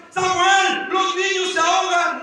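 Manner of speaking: high, loud cry for help; acted, urgent scream of his name; wife shouting. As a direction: call out frantically to a husband. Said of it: "su esposa le gritaba".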